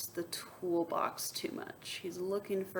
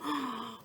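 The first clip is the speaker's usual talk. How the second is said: sharp mock gasp for the kids; dramatic teacher gasp; 3 semitones higher than usual. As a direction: gasp as if surprised. Gasp at story moment.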